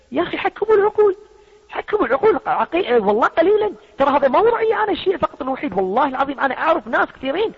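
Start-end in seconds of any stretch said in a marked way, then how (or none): 0.1-5.7 much higher, pleading cry; high exasperated plea; exasperated appeal. Real, not acted. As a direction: throw your voice up in frustration. Exasperated appeal to use reason.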